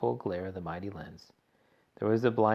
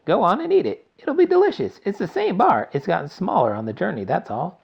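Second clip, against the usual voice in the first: much higher, louder delighted coaxing; excited urging; 9 semitones higher, 9 dB louder than usual. A narrator's reading. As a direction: coax him giddily, fading at the end. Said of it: "shouted Mister Wonka".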